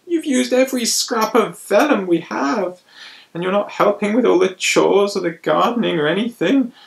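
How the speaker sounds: high, loud complaining priest; complain, fed up with him; grumbling priests. Acted. Priests complain about the boy.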